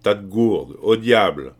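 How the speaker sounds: loud, scornful curse; emphatic outburst of contempt; contemptuous. A narrator's reading. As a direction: spit out the insult with force. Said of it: "un murmure de mépris".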